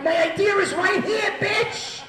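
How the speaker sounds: high, furious full-on scream; screaming back. Retold or imitated. Comic screams back at the woman demanding ID, frenzied yelling. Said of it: "I'm starting to scream back at this woman".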